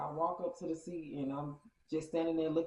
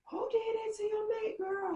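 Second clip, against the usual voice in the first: shocked squeal. Retold or imitated. Friend's cousin gasps at hickey.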